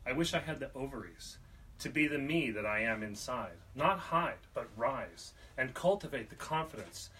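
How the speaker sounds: rhythmic spoken-word delivery, phrase by phrase; poetry reading. Acted. Poet reading a poem of wishing for ovaries, emphatic poetry reading. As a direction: lift the key phrases, then let them fall. Reads own poem aloud.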